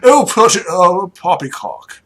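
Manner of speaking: loud, high lordly dismissal; pooh-pooh it like a pompous duke; blustering aristocratic scorn; scoffing duke. Acted. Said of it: "He's like".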